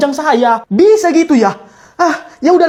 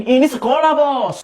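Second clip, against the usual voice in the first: ordinary talk, nothing marked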